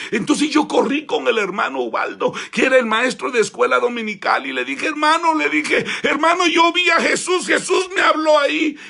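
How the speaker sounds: high, loud urgent exclaiming; excited shout. Acted. Boy telling the Sunday school teacher, shouting. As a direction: shout the news eagerly.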